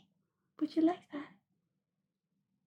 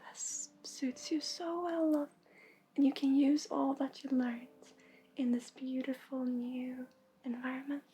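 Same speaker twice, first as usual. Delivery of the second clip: hushed, tender reading voice, half whispered; soft channelled message; 7 dB quieter than usual. Acted. Reader telling a viewer she is worthy, in a soft half whisper. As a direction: breathe the words to her gently like a blessing.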